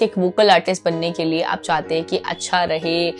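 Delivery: normal talking voice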